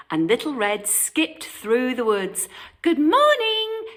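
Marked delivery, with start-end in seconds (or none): 2.8-3.9 high, loud happy greeting; girl calling to rabbits and deer; cheerful cry. Acted. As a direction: call out gaily to the animals. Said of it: "she cried to the rabbits".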